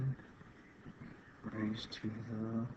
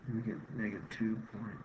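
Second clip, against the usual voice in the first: ordinary delivery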